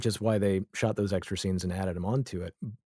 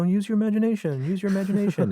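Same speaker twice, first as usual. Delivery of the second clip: lightly voiced execs, much higher and sing-song; mock network execs; 10 semitones higher, faster than usual. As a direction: tease them in a high coaxing voice. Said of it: "they're just like, you know".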